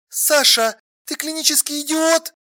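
high woman's voice, quietly pronouncing judgement; hardly questioning, stating. A narrator's reading. Alla passing verdict on Sasha, not asking. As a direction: state the insult as plain fact. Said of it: "в её голосе снова почти не было вопросительной интонации".

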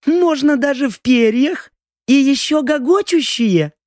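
high, wry mocking voice; mocking smirk. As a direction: tease him with a crooked smile. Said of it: "Лаура криво усмехнулась".

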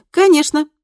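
high, loud bright assurance; eager reassurance. A narrator's reading. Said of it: "заверила Кристина".

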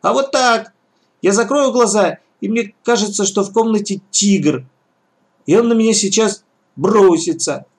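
put-on high frightened little boy; a scared kid explaining; child voice. A narrator's reading. (испуганный детский голос)

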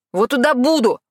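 loud angry yell at her. Acted. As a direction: shout it back in anger. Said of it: "наорал он".

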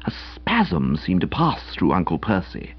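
ordinary delivery, nothing marked

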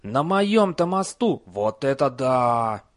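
high, loud, slow excitement; thrilled. Acted. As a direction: gush with delight. Troll thrilled.

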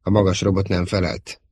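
usual speaking voice

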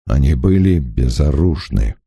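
low, restrained anger; cold, low accusation; angry. A narrator's reading. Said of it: "гневно глядя на Луазеля".

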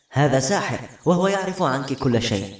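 ordinary delivery, nothing marked

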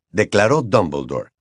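usual speaking voice

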